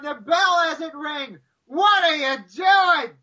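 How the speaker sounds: high-pitched frantic yelling at the TV, re-enacted; screaming at the top of lungs. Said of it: "I was screaming at the top of my lungs".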